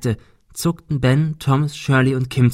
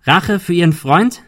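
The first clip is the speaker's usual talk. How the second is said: high, loud rising question; shouted accusing question; calling out; 6 semitones higher, 5 dB louder than usual. A narrator's reading. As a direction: throw the question at him loudly. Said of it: "rief Ben".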